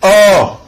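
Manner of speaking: O held long and high, then falling; sustained O, as a demonstration. Shown as a demonstration. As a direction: draw out the open vowel O. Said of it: "la formation de certaines voyelles longues".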